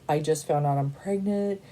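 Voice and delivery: ordinary delivery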